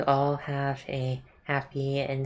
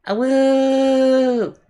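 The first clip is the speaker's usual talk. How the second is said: long, high, loud wolf howl; 13 semitones higher, 12 dB louder than usual. Acted. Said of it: "I've decided to howl to this".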